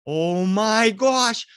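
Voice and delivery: very slow, hushed oh my gosh; drawn-out awe. Retold or imitated. Uncle staring into window, slow awed gasp. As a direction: stretch it out in dread.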